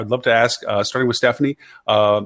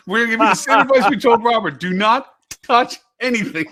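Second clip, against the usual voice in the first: laughing; 10 semitones higher than usual. Real, not acted. Joking warning through laughter.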